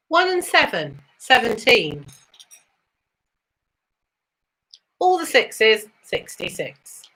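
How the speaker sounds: sing-song bingo number calls, rising then falling; bingo caller. Acted. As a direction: call each number like a bingo hall host.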